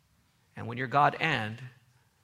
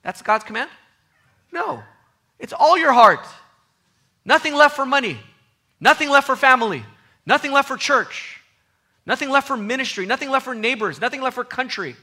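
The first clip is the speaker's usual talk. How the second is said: loud, much higher, stressing "left" each time; full-force preaching; 11 semitones higher, 11 dB louder than usual. Acted. Preacher rebukes the divided heart, in loud, high preaching. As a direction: cry out every "Nothing left for" line.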